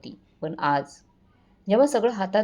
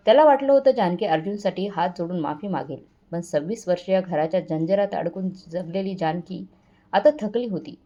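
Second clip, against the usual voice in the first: usual speaking voice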